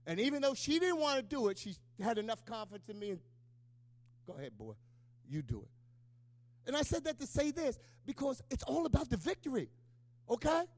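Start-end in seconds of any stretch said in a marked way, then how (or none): 4.3-5.7 low, soft mother's consent; quietly giving in. Retold or imitated. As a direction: retell her reluctant go-ahead, hushed and low.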